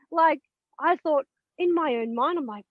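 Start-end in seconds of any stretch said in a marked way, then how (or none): none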